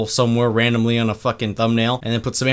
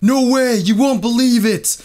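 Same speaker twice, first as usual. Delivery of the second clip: high, louder fake hype; hammed-up clickbait yell; mock shouting; 8 semitones higher, 3 dB louder than usual. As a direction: yell it like an all-caps title.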